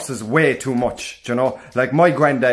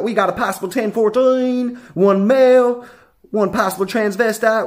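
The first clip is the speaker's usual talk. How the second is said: higher-pitched mock police radio report; cop on walkie-talkie; 7 semitones higher than usual. Guard reporting suspects over a walkie-talkie, mock radio voice. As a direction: imitate an American officer radioing in. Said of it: "came over with a walkie-talkie".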